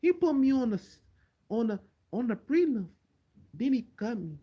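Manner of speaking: lightly voiced Brazilian-accented Anderson Silva; a hint of Anderson Silva's Brazilian accent, complaining; Anderson Silva impression. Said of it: "the best Anderson Silva accent I ever heard".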